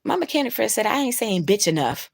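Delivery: ordinary talk, nothing marked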